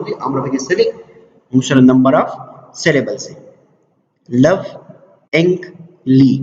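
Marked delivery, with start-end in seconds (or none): 4.4-6.3 لوینګلی very slowly in three parts, pausing after each; syllable by syllable. Shown as a demonstration. Teacher giving learners another example, لوینګلی said syllable by syllable. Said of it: "three vowel sounds".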